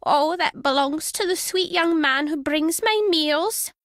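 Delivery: put-on high, slow doddery old lady. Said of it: "There, is this better?".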